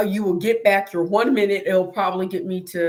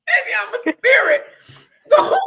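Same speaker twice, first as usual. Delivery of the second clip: high, laughing, almost crying; laughing till it sounds like crying; 12 semitones higher, 6 dB louder than usual. Real, not acted. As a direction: lose it laughing at yourself. Laughing retelling.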